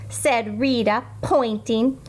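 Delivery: plain reading voice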